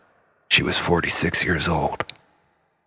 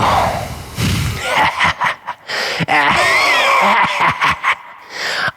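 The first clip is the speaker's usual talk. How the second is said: hammed-up evil laugh that gloats over Lisa; evil shouted cackle; 11 semitones higher than usual. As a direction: cackle like a cartoon villain.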